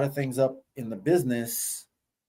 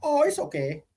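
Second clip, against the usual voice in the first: put-on Japanese accent; 11 semitones higher than usual. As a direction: repeat it in a high Japanese accent. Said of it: "What she said?".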